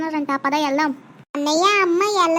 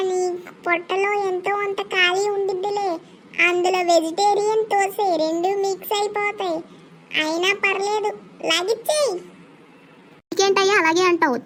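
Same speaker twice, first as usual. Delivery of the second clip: ordinary talk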